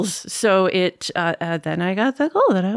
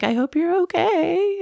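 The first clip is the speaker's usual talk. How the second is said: concerned friend; 11 semitones higher, slower than usual. Retold or imitated. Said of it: "Murray's like".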